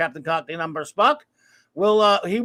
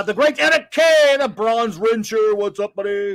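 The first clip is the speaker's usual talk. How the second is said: announcer-style shout-out; 2 semitones higher, 5 dB louder than usual. Pretend. Host greets a viewer by name, hyped announcing. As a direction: call out the name with showy gusto.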